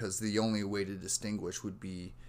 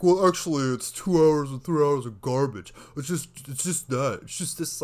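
loud, raised mock critic voice; hammed-up contrarian picking a film apart; half-ass film critic; 5 semitones higher, 10 dB louder than usual. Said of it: "some sort of half-ass film critic".

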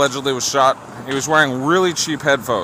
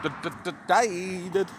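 mock stutter; 3 semitones higher, 5 dB quieter than usual.

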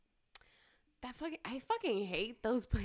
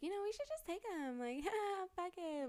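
mock carefree dare; 11 semitones higher than usual. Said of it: "we were like".

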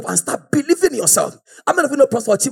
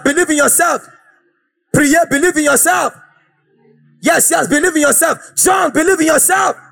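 full preaching; 5 semitones higher than usual. Acted. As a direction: fire up the congregation.

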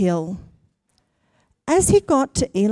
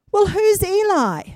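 higher, puzzled little puppet voice; Punchinello's voice; 10 semitones higher than usual. A narrator's reading. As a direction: ask like a small confused wooden boy. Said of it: "And Punchinello said".

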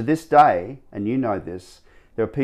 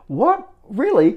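shrieking high, louder "What? Really?"; can't believe the news, voice leaps up; stunned disbelief; 6 dB louder, slower than usual. Retold or imitated. Hearing he got the trainer job, high stunned disbelief.

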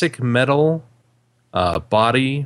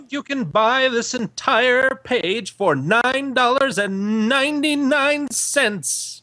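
much higher, slow vintage ad pitch; old-timey radio announcer; 10 semitones higher than usual. Acted. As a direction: sell it like a 1930s radio ad. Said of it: "old-timey radio voice to announce the prices".

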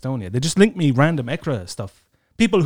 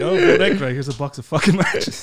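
mock campaigner; 2 semitones higher than usual. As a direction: hand out matches like a cheery canvasser.

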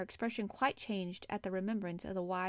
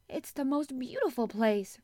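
higher, louder keen protest; 5 semitones higher, 5 dB louder than usual. A narrator's reading. Girl defends the moor to the boy, protesting. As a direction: object warmly, with pitch and volume up. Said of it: "protested Mary".

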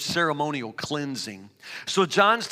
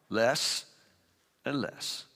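fading to quiet; 9 semitones lower, 9 dB quieter, much slower than usual. Acted. Shrinking voice on "less and less.

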